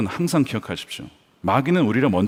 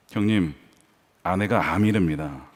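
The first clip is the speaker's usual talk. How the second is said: low, soft voice of a stricken husband; retold hushed, heavy confession; subdued bad news; 9 semitones lower, 6 dB quieter than usual. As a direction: break grave news quietly, low. Retold phone call with bad news.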